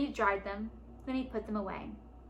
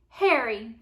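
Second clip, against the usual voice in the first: loud punchline call; loud cry; 8 dB louder than usual. A narrator's reading. Father calling out his joke to his son, loud cry. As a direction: call it out to the table. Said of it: "Fergus cried".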